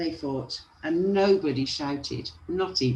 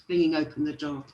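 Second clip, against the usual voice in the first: ordinary delivery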